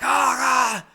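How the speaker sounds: very high, loud imitation of panicked roommate; panicked shout. Deaf roommate reacts to screeching hearing aid, panicked yell.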